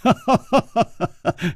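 high, staccato giggle; bright run of acted giggles; giggling cackle. A narrator's reading. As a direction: cackle merrily in a string of high notes. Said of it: "花金公咯咯的笑着".

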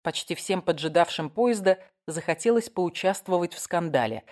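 plain reading voice

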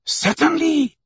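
very high, eager outburst; impetuous exclamation. A narrator's reading. Servant breaks into the hearing, impetuous. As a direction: burst in at a high pitch. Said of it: "exclaimed Passepartout impetuously".

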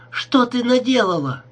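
screaming it again in horror. A narrator's reading. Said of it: "крикнула она Герде".

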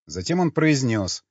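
plain reading voice

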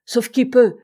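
loud, high cry of alarm; every-man-for-himself panic; panicked cry. A narrator's reading. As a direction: call it out to the whole ship. Panicking crew voiced by narrator.

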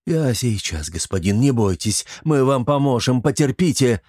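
ordinary delivery

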